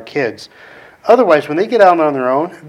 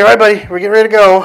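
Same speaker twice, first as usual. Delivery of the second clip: upbeat call; 6 semitones higher, 7 dB louder, much faster than usual. Retold or imitated.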